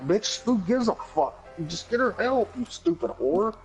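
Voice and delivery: high mock outrage at the dispatcher; mock angry rant. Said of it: "You're like".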